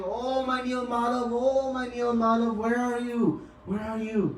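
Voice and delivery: a touch of lament, pitched high; mock wailing call for a lost deity; crying out. Devotees left behind call out for Nilmadhav, mildly wailing. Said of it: "all we could hear was crying".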